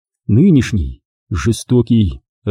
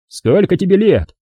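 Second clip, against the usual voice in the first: higher, barked question; near bark; 8 semitones higher than usual. A narrator's reading. Brigade commander interrogating the prisoner, nearly barking. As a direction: snap the question at him. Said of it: "почти рявкнул комбриг".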